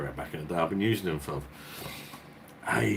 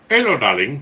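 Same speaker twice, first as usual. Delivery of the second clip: put-on a light Indian accent, sing-song and raised; just a hint of an Indian accent; slight Indian accent; 7 semitones higher, 11 dB louder than usual. Shopkeeper greeting back in a slight Indian accent. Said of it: "in a slightly Indian sort of tone".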